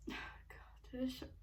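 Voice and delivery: hushed sigh then whispered complaint; breathy and quiet from the spice; whispering. Real, not acted. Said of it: "makes me burp a lot".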